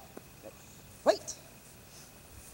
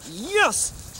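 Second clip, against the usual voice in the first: usual speaking voice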